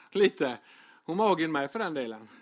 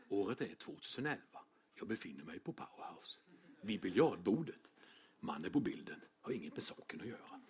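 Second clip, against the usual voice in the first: much lower and softer, mock-solemn documentary narration; film-strip narrator voice; 10 semitones lower, 15 dB quieter than usual. Preacher parodies a documentary narrator for his own story, low and hushed. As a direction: play the old school slideshow voice, deep and hushed. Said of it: "Skulle man säga så här".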